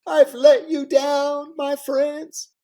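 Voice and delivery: very high, loud, slow tearful wail; Clinton fake sobbing. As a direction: ham up the crying apology even harder. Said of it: "And he starts to cry".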